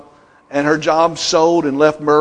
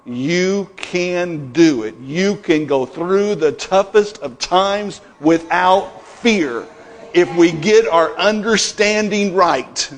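ordinary delivery

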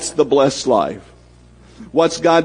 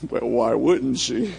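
slow, self-satisfied drawl; slow mock-smug brag; smug vanity; 3 semitones lower, 8 dB quieter, slower than usual. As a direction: preen like a flattered fool. Flattered man brags to himself.

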